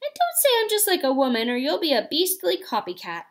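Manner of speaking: put-on quick, high girlish voice, rushed; a girl hurriedly taking it back; hasty. A narrator's reading. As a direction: blurt it out hastily in her own voice. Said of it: "added hastily in her real voice".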